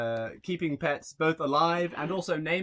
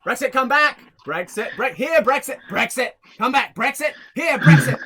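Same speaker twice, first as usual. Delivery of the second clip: higher, louder calls for a lost dog; shouting for the dog; 7 semitones higher, 9 dB louder than usual. Retold or imitated. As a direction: yell the dog's name down the street. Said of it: "he was shouting".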